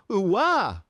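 high, loud squealing coo; cutesy squeal. Acted. Voicing two blushing cute characters, squealing. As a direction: squeal like a swooning anime fan.

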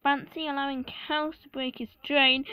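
loud mocking line ending in a big laugh; hammed-up derisive laughing; Gordon laughing. A narrator's reading. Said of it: "laughed Gordon".

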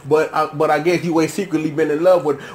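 normal talking voice

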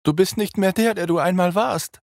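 screaming vampire woman. A narrator's reading. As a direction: spit the accusation out.

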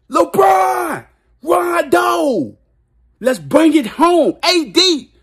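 high cheering shout of names, then slow; hype shout. Real, not acted. Fan shouting his players' names for the Lakers to win. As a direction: yell them on like a fan in the stands.

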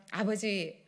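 usual speaking voice

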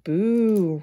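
loud crowd jeer; roaring boo. Acted. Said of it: "roared the crowd".

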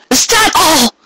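high, sharp demand. A narrator's reading. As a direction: press him hard. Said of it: "she demanded of him".

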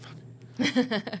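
normal talking voice

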